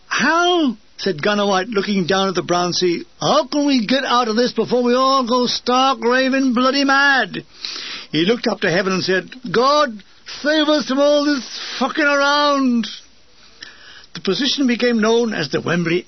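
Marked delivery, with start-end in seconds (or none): 0.1-0.8 high, drawn-out wail; despairing gunner. A narrator's reading. 3.2-7.4 high exasperated plaint; mock-desperate, pitched up; despairing gunner. A narrator's reading. 9.5-12.9 high, drawn-out supplication; mock prayer, pitched up; plea to heaven. A narrator's reading. Gunner pleading to heaven.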